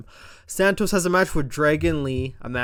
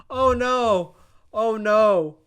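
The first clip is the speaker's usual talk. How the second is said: higher, louder hammed-up oh no; fake dismay; 7 semitones higher, 6 dB louder, much slower than usual. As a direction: overact a fake panic. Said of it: "Logan's like".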